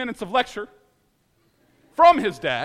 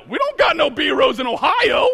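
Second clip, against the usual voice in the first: indignant protest; 5 semitones higher, 5 dB louder than usual. Retold or imitated. Arguing with Tim on phone.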